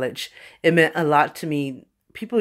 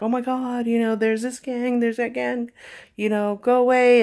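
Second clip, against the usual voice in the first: panicked classmates; 6 semitones higher than usual. Retold or imitated. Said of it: "everybody's like".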